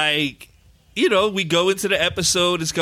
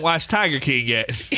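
ordinary delivery

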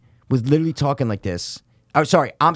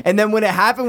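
usual speaking voice

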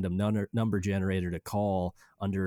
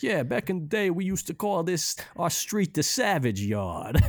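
mock goomba monologue; 8 semitones higher than usual. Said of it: "you went on a whole thing about".

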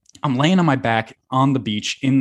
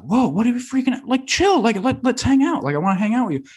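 friend urging; 12 semitones higher than usual. Retold or imitated. Friend Mateo voiced urging to stay.

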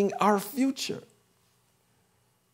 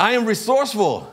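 leading affirmation, raised; 4 semitones higher than usual. Real, not acted. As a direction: call it out for the room to echo. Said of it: "you can affirm with me".

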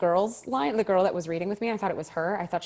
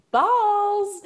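high, loud drawn-out exclamation; comic cry of dread; mock dismay; 6 dB louder than usual. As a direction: blurt it out high and stretch it long. Dismayed reaction to being asked first.

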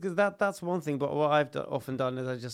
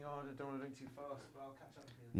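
inner thought; 15 dB quieter, faster than usual. Retold or imitated. Inner thought about drinking pace.

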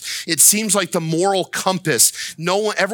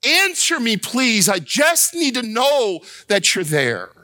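high, pleading appeal; crying out; 5 semitones higher than usual. Acted. Prophet begging the Lord for an answer, crying out high. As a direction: plead high, then come down toward the end. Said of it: "he's crying out".

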